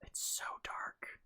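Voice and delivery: slow hushed whisper on so dark. Real, not acted. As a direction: breathe out It's so dark, no voice. Creeping through dark room.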